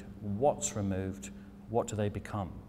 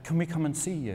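eager American couple; 7 semitones higher than usual. Retold or imitated. Americans begging to visit the British silversmith, high and eager. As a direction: mimic an overeager fan. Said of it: "And like".

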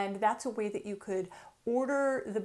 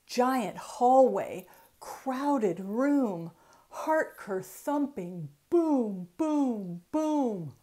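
lilting, rhythmic picture-book reading; reading to children; 5 dB louder, much slower than usual. Acted. Author reading her book aloud to children, lilting storytime. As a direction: read each rhyme like a bouncy storytime.

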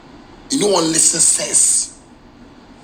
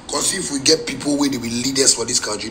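ordinary talk

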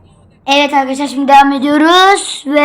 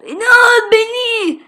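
pleading voice; 8 semitones higher than usual. Pretend. Pleading in character voice.

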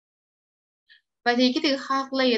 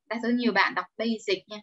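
ordinary talk

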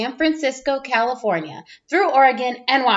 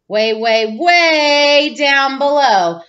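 drawn-out way down below; 4 dB louder, much slower than usual. Acted. Looking down from tall truck.

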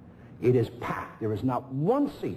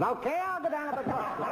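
much higher, laughing through the line; high imitation. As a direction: squeak it out, cracking up. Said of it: "I'm trying to imitate them".

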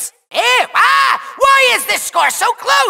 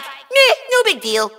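dismissive shrug; 4 semitones lower than usual. Acted. Villain says he is no longer worried, dismissive shrug. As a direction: wave it off, unbothered. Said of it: "now I'm just like".

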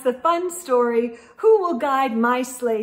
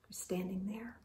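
whisper; 5 semitones lower, 16 dB quieter than usual. Real, not acted. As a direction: lean in and whisper it. Whispered aside to young listeners.

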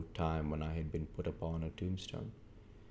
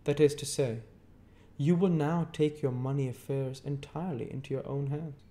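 stern lawyer Jaggers; 8 semitones higher than usual. A narrator's reading. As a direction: hold the witness with a hard stare. Jaggers announcing Pip's fortune.

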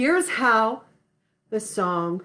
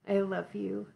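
tender; 3 semitones lower, 8 dB quieter than usual. Real, not acted. Tender goodbye to children.

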